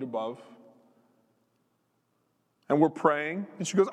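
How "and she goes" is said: ordinary talk, nothing marked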